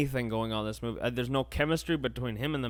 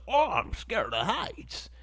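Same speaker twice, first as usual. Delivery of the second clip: higher, slow mock whine; mock scared hero; 9 semitones higher, slower than usual. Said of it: "He's just like".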